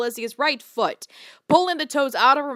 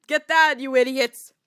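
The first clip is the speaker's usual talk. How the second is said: ordinary delivery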